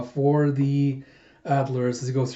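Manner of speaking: usual speaking voice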